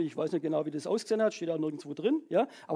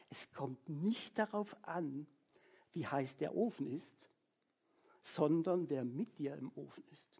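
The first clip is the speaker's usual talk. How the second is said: soft quoted saying; 5 semitones lower, 8 dB quieter than usual. Shown as a demonstration. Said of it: "Vielleicht kannst du den Satz merken".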